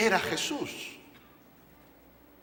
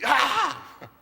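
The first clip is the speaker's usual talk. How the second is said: sudden high cry of fright; terrified scream; 5 semitones higher than usual. Shown as a demonstration. Preacher imitating disciples' terrified cry. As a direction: scream like men seeing a ghost. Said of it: "hay una gran diferencia entre".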